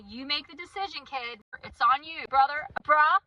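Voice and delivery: mock-stern parent, building to loud; playful tough-parent warning; parental ultimatum. Acted parent's ultimatum to defiant kid.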